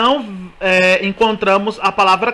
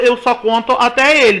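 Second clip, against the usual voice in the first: ordinary delivery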